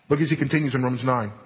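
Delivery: ordinary talk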